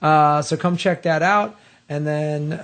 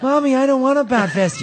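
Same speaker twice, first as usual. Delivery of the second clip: put-on child voice; 9 semitones higher than usual. Child whining to mom.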